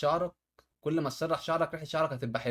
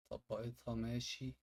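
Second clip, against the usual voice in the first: usual speaking voice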